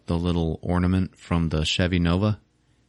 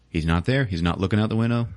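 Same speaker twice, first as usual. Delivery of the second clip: plain reading voice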